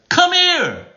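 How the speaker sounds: very high, loud summons; high-pitched calling out; different pitch. Shown as a demonstration. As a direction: shoot the pitch way up. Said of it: "different pitch".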